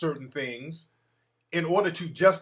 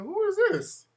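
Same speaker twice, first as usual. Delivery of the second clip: very high, squeaky retold question; high-pitched mock disbelief; mock bafflement. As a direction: squeal it in exaggerated confusion. Acts out own baffled reply.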